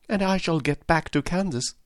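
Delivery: high-pitched, delighted Dorothy; excited cry. A narrator's reading. Dorothy, eager to get back to Kansas, crying out excitedly. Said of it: "cried Dorothy, clapping her hands".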